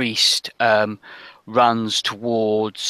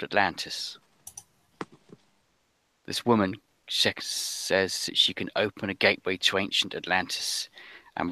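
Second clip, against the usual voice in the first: softer, halting account with long pauses; frightened girl's confession; 3 semitones lower, 5 dB quieter than usual. Acted. Little girl tells rescuers of the witches, hushed and halting.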